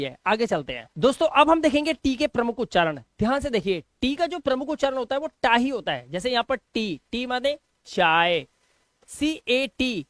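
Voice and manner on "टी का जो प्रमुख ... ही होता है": usual speaking voice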